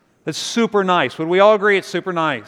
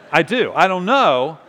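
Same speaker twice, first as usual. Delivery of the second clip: ordinary talk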